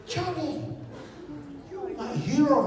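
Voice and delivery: very high, soft little voice; mock hero worship in a high hush; high-pitched whimper of admiration; awed admiration. Child awed by his friend.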